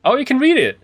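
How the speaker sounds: high, loud surprised exclamation; sudden delighted oh. Real, not acted. Suddenly finds readable note.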